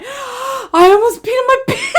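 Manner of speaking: high, loud, laughing through the words; can barely speak for laughing; retelling the husband's line, cracking up for real; laughing hard. Real, not acted. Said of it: "I was laughing so hard".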